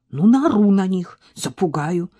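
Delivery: usual speaking voice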